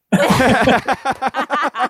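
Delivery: a high burst of laughter at his own joke; a real laugh at the punchline. Real, not acted.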